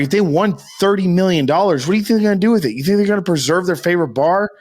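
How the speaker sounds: ordinary talk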